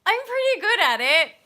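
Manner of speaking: high, loud playful brag; cheeky boast. Real, not acted. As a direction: show off a little. Boasting about accents.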